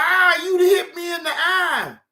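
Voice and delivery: hammed-up complaining whine, a bit lighter; mocking whine. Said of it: "you keep talking about".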